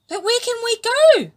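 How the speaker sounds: high, loud worried voice; frightened flatfish asks where to go; anxious question. A narrator's reading. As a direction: ask with mounting fear.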